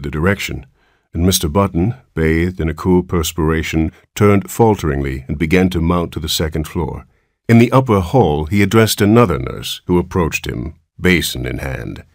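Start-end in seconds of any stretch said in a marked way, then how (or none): none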